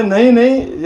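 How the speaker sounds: loud, high no no; firm refusal. Acted. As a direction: shut him down loudly. Gopi refuses to let Krishna go. (ज़ोर से, ऊँचे स्वर में इनकार)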